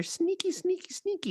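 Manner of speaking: high, soft squeaky sing-song; squeaky sneaky voice. Acted. Playful teasing about sneaky Supercell.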